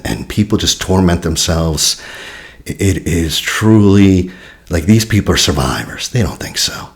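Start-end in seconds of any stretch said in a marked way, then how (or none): none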